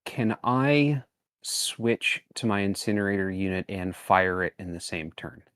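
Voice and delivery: normal talking voice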